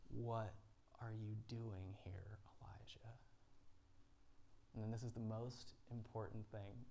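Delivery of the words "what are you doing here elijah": slow, breathy whisper of God; God speaking in a breath; the Lord whispers gently to Elijah; gentle whisper. Acted. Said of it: "a voice said to him".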